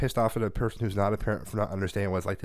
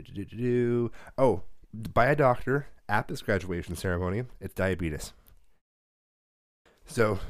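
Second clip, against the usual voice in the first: ordinary talk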